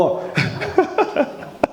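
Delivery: light breathy chuckle. Real, not acted. Speaker amused by own remark to congregation, chuckling. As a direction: chuckle to yourself mid-talk.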